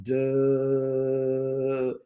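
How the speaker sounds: long monotone "duh"; blank, held-out dullard noise; vacant dummy. Acted. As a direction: drone it out like someone completely checked out. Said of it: "she just sitting there like".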